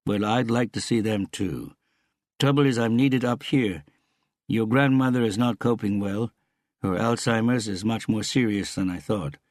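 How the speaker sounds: a hint of an earnest father on the phone; concerned father. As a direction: explain family trouble with a firm, lifted voice. Voices the father character.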